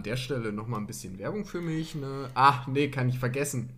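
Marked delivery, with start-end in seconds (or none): none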